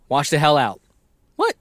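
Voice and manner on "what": ordinary delivery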